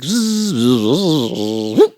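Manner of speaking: long zipping zzz; buzzing hummingbird. Retold or imitated. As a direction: buzz like a bird zipping out and back. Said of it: "back out, is like".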